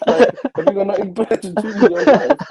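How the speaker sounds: high and loud, disgusted mock rant; family scolding. Parents mocking son for arguing online, high and loud. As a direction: tear into him like a fed-up parent, laughing at the end. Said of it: "they'll look at me like".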